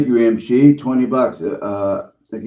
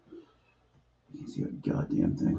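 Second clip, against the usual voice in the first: muttering; 12 dB quieter, much slower than usual. Real, not acted. Muttering without glasses.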